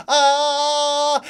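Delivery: high loud charging yell; a running battle cry. Acted. Ed charging at the water, high loud yell.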